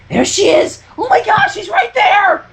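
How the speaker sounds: high, thrilled fangirl squeal; cried happily. Acted. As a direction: burst out with delight. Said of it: "Rainbow cried happily".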